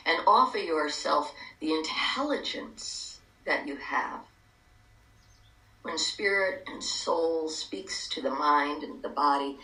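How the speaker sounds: calm guided meditation, hushed. Acted.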